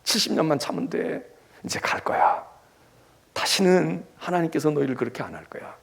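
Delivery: gentle near-whisper of encouragement; soft whisper. Acted. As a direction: whisper hope to the weary exiles. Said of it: "희망을 불어넣어준다 이거예요".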